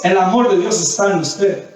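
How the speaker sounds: ordinary talk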